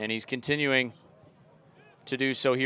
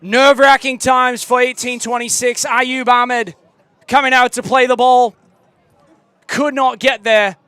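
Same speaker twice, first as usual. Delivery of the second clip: put-on loud English accent commentary, higher; louder mock English commentator; 4 semitones higher, 6 dB louder than usual. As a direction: keep the British broadcaster call going, tense. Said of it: "let it rip".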